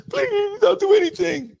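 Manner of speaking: imitating her plea, pitched very high; very high mock-begging woman. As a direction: squeak out her desperate please. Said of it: "you told me you on your knees".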